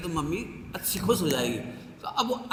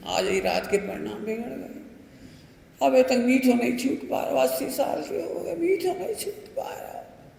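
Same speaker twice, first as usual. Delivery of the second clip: lightly voiced grumbling old man, rising higher; half-played complaining elder, pitched up at the end; mock old man's whine; 5 semitones higher than usual. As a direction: whine like an old man who can't quit sweets. Old man complaining about sweets.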